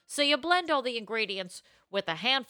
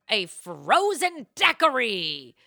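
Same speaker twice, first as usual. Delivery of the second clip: drawn-out, grand reveal of the drink; drink announcement; 2 semitones higher, 5 dB louder, slower than usual. Pretend. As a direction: announce it slowly, with a flourish.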